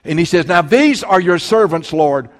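loud, fervent sermon delivery; impassioned preacher pleading with God; full-force preaching. Acted. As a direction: preach it out with fire.

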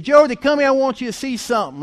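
high, loud retold dad's call; calling someone over. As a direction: imitate a dad calling you to come look. Dad calls child over.

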